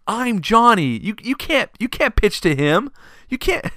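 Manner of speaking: put-on high, loud, panicky kid voice; panicked kid Johnny. Johnny hears the play called, panicked kid voice. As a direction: freak out like a little kid. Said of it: "he goes".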